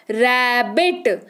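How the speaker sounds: rabbit stretched out slowly; slow model word. Shown as a demonstration. Teacher models rabbit for students, slow and clear. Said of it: "उदाहरण के तौर पर".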